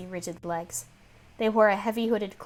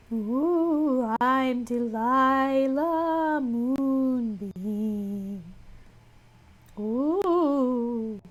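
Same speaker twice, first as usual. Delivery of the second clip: very slow, higher spooky ghost voice; cartoon ghost voice; 4 semitones higher, much slower than usual. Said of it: "talk kinda slowly and weird like a cartoon ghost".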